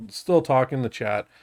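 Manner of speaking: ordinary delivery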